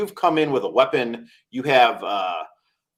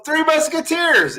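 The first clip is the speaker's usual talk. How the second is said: mock indignant friend; slower than usual. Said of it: "and you go".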